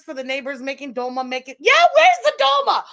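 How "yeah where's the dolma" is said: high, loud exclamation; outburst of realization. Real, not acted. Streamer suddenly remembering the promised dolma, loud outburst. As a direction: burst out as you suddenly remember.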